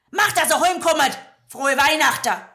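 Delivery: loud, high innkeeper sending them off; curt dismissal. Acted. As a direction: slam the door with words. (Wirt wirft sie grob hinaus, laut)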